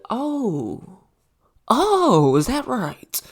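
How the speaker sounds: exaggerated high-pitched "oh"; mock disbelief. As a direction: react with hammed-up, drawn-out incredulity. Mock reaction to the quoted defiance.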